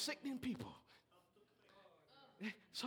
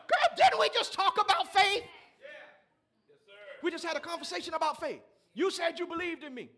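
a fed-up friend calling her out; sassy preacher reprimand; loud scolding; 8 semitones higher, 14 dB louder than usual. Acted. Jesus scolds Martha, paraphrased.

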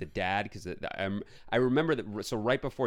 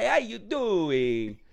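much higher, slow hammed-up Italian greeting; mock Italian accent; 12 semitones higher, 6 dB louder, much slower than usual. Mocking Fabrizio's accent, slow overdone Italian. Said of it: "Italian accents".

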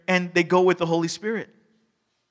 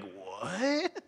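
gasped what; 10 dB quieter than usual. Retold or imitated. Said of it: "an audible gasp from everybody".

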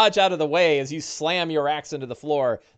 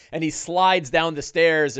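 ordinary talk, nothing marked

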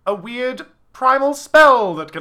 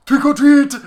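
mock Voldemort greeting; 7 semitones higher, 9 dB louder than usual. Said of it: "He comes in, he goes".

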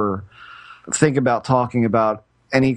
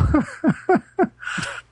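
sudden high-pitched laugh; high burst of laughter. Real, not acted. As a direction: let out a bright, high laugh. Laughs at the overheard line.

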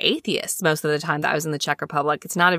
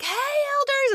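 high singled-out hail, eager; excited greeting; 3 dB quieter than usual. Retold or imitated. Traveller hailing missionaries she runs into, excited high call. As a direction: call out a friendly hello, pitched way up.

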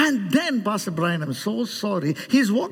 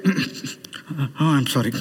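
low, choked-up imitation of an apology; mock tearful apology; 9 semitones lower, much slower than usual. Imitating people who cry and then apologise, mock tearful. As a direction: apologise as if caught crying. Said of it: "people who cry say".

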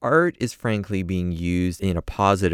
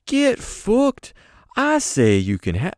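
put-on Scottish accent creeping in; [name] voice sliding into Scottish; [name] slipping into Scottish; 13 semitones higher, 4 dB louder, slower than usual. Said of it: "Now I'm turning Scottish".

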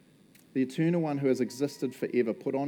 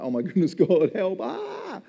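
louder, rising into a high panicked ah; mock freak-out; 6 semitones higher, 5 dB louder than usual. Pastor retelling his own panicked prayer, higher and louder. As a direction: cry for help in a fluster. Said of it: "I'm freaking out and I'm like".

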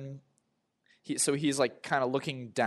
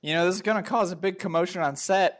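raised, higher calling voice; yelling after someone; 8 semitones higher, 5 dB louder than usual. Acted. Handler to crewmate walking away, yelling after him. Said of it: "I yell after you".

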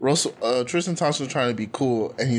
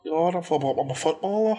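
put-on high, ditzy Liverpool accent; sing-song Liverpool lilt, pitched up; mock Scouse accent; 5 semitones higher than usual. Host imitates the singer's Scouse accent, pitched up. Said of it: "that's really what she sound like".

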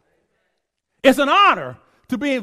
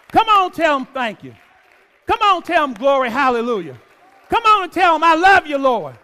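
preaching at full force; 5 semitones higher than usual. Acted. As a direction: preach it hard to the congregation. Fervent preaching over music.

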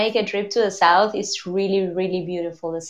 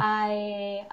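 ordinary talk, nothing marked

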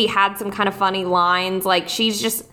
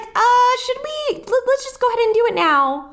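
put-on nervous girl; 14 semitones higher than usual. Lindsay nervously pushing Caleb.

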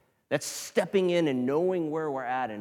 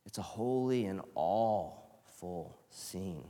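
"holy" and "awful" drawn out slowly and softly; slow, hushed emphasis; 3 semitones lower, 5 dB quieter, slower than usual. Shown as a demonstration. Preacher repeating the quote to the congregation, slow and hushed. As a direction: let "awful" sound like awe, slow and hushed. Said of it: "he spells it A W E F U L".

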